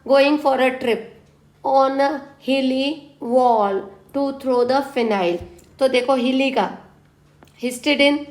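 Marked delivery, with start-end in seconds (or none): 0.1-5.4 slow mnemonic recap. Shown as a demonstration. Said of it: "बोला था".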